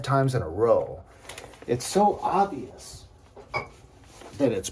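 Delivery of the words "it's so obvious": exasperated. Real, not acted. Exasperated repeat.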